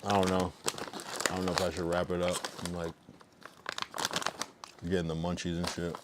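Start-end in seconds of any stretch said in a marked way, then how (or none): 0.0-0.5 talking while eating; words round a bite of food; mouth full. Real, not acted. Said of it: "Sorry, I'm just eating".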